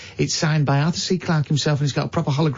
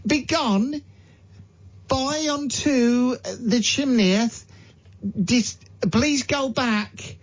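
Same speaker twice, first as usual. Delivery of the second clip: higher, slow commanding banishment; mock exorcist; 6 semitones higher, much slower than usual. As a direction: cast the ghost out grandly. Banishing the ghost boy.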